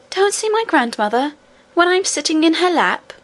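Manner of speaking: put-on a little girl who cannot believe it; exclaiming. A narrator's reading. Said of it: "exclaimed the princess".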